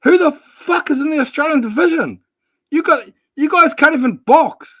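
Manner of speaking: outraged rant at the Aussie chat; heated outburst. Real, not acted. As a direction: blow up at him in disbelief. Heated argument over boxers.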